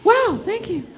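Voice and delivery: high mock-surprised gasp at a cake; fake surprise. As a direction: play it up like an act. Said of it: "the part that I'm supposed to go".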